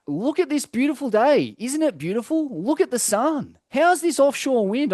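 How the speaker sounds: high, excited gushing about the perfect day; imitating Rasta's excited gushing. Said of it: "I'm like".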